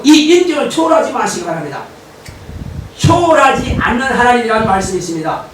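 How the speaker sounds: usual speaking voice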